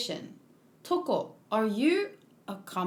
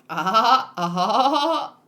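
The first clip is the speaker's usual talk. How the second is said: forced loud ah-ah laugh; obviously faked chuckle; fake laugh; 2 semitones lower, 6 dB louder than usual. Shown as a demonstration. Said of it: "I can only do fake laugh".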